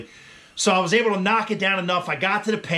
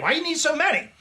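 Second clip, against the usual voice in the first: incredulous; 10 semitones higher, faster than usual. Retold or imitated. Crew voiced incredulous.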